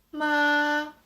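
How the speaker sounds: "ma" high, loud and held level; first tone. Shown as a demonstration. Teacher contrasts four tones, "ma" high and flat. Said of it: "The first tone is".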